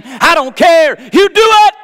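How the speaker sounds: high, full-throated shout to God; shouted prayer. Retold or imitated. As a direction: cry out the demand at the top of the voice. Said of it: "I got to shouting".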